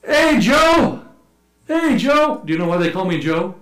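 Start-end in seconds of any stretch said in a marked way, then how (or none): 0.0-2.4 high, loud imitated street holler; excited pointing-and-yelling impression. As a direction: call out to a passing foreigner. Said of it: "they point and yell out".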